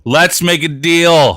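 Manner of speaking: high, loud announcer call; game show host call. Retold or imitated. Echoing game show title.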